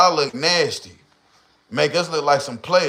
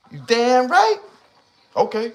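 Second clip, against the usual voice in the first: mock woman; 8 semitones higher than usual. Voiced woman reply.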